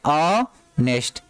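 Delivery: Honest split slowly into "ऑ... nest"; slow pronunciation demo. Shown as a demonstration. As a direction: hold the first vowel of honest, then finish. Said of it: "इसको honest पढ़ते हैं". (Honest को धीरे से दो हिस्सों में बोलें)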